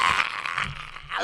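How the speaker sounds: high drawn-out scream of panic; hammed-up victim's scream; mock scream. Dominic grabbed by the attacker, hammed-up scream. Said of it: "Dominic's like".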